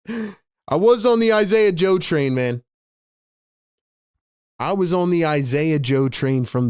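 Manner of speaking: loud, high-pitched proud outburst; excited boast. Real, not acted. Fan bragging of backing Isaiah Joe, excited shout.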